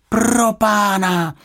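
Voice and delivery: high, loud, slow gasp of alarm; girl startled by water dripping from the ceiling; startled exclamation. Acted. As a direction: blurt it out in shock. Drip falls from the ceiling.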